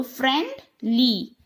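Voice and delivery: friendly drawn out slowly, both syllables clear; teacher sounding out syllables for pupils; slow syllable demo. Shown as a demonstration. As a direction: stretch the word so the syllables show. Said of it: "Here you can have two words".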